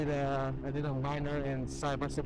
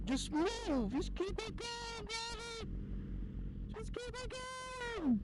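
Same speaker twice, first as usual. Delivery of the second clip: very high mock voice; 6 dB quieter, slower than usual. Urging traffic, voice way up.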